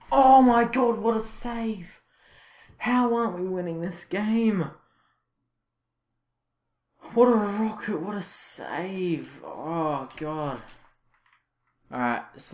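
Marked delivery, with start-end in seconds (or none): 0.1-4.7 high, loud cry of disbelief; pitched-up, stunned complaint; exasperated outcry. Real, not acted. Outcry at another save. 7.1-10.6 high, drawn-out disbelief; stunned, high-pitched groan of admiration; anguished amazement. Real, not acted. Agonized at a great save.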